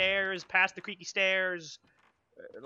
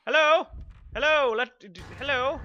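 calling out; 6 semitones higher, 7 dB louder than usual. Real, not acted. Calling out to a ghost.

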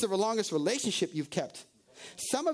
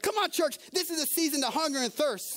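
shouted preaching; 6 semitones higher than usual. Acted. Preacher shouting duties at the congregation, forceful. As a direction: shout each command to the church.

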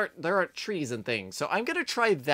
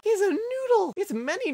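gasp, then high delighted squeal; excited squeal; 14 semitones higher than usual. Real, not acted. Player spots a noodle creature, excited squeal. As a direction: squeal in delight at a cute sight.

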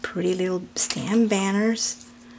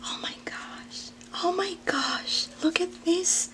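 breathless, higher whispered gasp of awe; awed whisper; 8 semitones higher, 10 dB quieter than usual. Real, not acted. Seeing the gifts inside, she whispers in amazement. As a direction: gasp it out in wonder. Gasps at the box contents.

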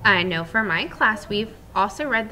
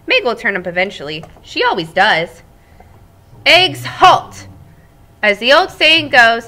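lively read-aloud voice for the class; storybook narration; 4 semitones higher, 12 dB louder than usual. Acted. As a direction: tell it like a cheerful egg to children.

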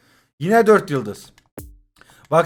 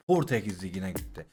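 much lower and softer, under the breath; resigned mutter; 12 semitones lower, 13 dB quieter than usual. Real, not acted. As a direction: let it drop to yourself, defeated. Repeats pack result, then mutters it. (kendi kendine alçak sesle mırıldanarak)

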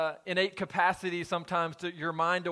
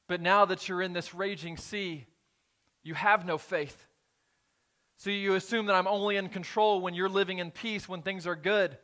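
louder preaching, rising toward the throne line; building sermon; 2 semitones higher, 4 dB louder than usual. Real, not acted. Preacher pressing the congregation about faith in storms, building louder. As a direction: drive the point home, building.

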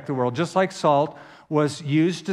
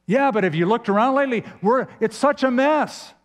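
quick, higher-pitched mock objection; complaining protest; 7 semitones higher, faster than usual. Preacher acts out a churchgoer objecting, complaining. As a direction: play an exasperated complainer. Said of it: "So we often complain".

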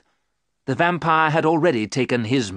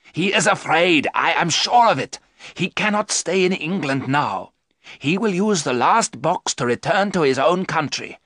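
louder, higher, certain Van Helsing; confident old doctor; 6 semitones higher, 3 dB louder than usual. Acted. Van Helsing convincing Mina, a lightly voiced doctor. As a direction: reassure them firmly.

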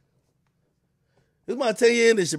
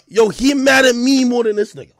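ordinary delivery

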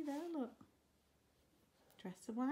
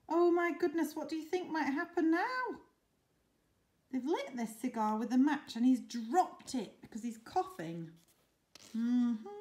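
plain reading voice

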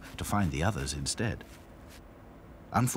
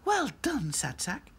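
Lucy voice; 14 semitones higher, slower than usual. A narrator's reading.

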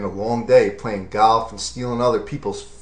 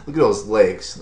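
ordinary delivery

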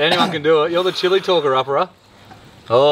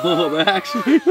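normal talking voice

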